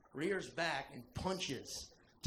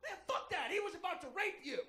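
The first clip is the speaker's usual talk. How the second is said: mock stranger; 12 semitones higher, faster than usual. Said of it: "this dude goes".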